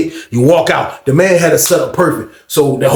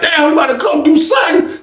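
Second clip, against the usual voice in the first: hyped; 11 semitones higher than usual. Retold or imitated. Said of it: "We thought we was about to be like".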